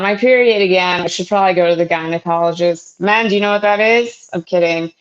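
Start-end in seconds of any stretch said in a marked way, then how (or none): none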